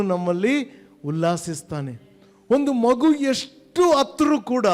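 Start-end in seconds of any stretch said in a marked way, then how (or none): 2.5-4.7 higher, louder impassioned preaching; fervent preaching. Acted. Preacher telling the flock of a mother's song, impassioned. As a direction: lift the congregation with the image.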